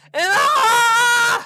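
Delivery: long, high, loud scream; drawn-out real yell of exasperation; frustrated scream. Real, not acted. Frustrated scream after dying.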